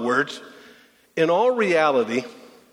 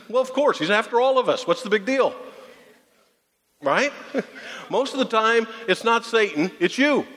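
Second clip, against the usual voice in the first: light, laughing retort pitched higher; amused rebuttal; 7 semitones higher than usual. Real, not acted. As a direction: tease them back, grinning.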